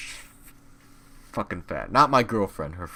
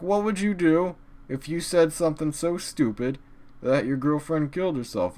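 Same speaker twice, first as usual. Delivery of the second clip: higher mock friend voice; 7 semitones higher than usual. Comedian quotes the friend's jab in a higher mock voice. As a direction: pitch it up to ridicule her.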